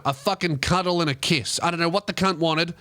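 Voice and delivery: normal talking voice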